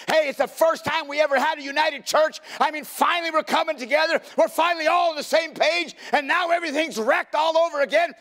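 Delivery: high, loud mock wail of a distraught Samuel; hammed-up anguish, pitched very high; preached weeping lament. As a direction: cry out the complaint at a preacher's shout. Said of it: "he's weeping. He's saying".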